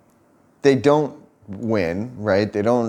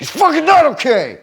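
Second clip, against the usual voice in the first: high, loud mock-furious comeback in a put-on voice; imitated angry retort; 6 dB louder than usual. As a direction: throw it back at him, fuming. Girlfriend snapping back at comfort.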